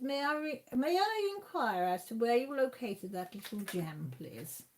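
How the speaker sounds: hammed-up posh enquiry. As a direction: put on a very polite posh English voice. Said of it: "Did you put your posh voice on".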